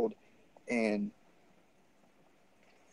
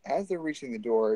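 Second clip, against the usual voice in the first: ordinary talk, nothing marked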